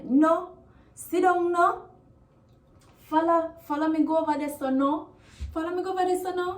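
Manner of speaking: "sit down no" pitched high, ending up on "no"; high-pitched "no" closing each request; high intonation. Shown as a demonstration. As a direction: raise the voice high through to the final "no". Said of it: "the intonation is kinda high".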